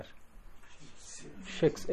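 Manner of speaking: very slow unvoiced シェイクス; whispered she sound. Shown as a demonstration. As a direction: breathe シェイクス slowly, voiceless. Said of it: "シェサウンドね".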